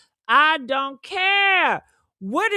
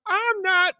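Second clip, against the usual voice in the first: ordinary talk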